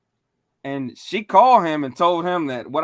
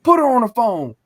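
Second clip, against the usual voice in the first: loud, raised-pitch order; imitated angry demand; 3 semitones higher, 6 dB louder than usual. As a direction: act out an uncle demanding the phone. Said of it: "went ham on her".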